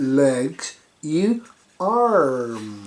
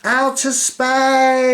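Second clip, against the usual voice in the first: higher, loud, very slow; dramatic; 8 semitones higher, 6 dB louder, much slower than usual. Acted. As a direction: make space sound vast. Dramatic outer space.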